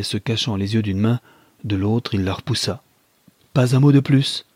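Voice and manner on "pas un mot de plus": loud, high-pitched command; raised voice, refusing to hear; crying out. Acted. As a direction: shout to cut her off. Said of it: "s'écria-t-il".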